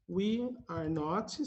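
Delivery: slow and separated, unabbreviated; slow model phrase. Shown as a demonstration. Teacher models the unabbreviated form, slow and separated. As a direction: say each word apart, unhurried. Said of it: "sem abreviar".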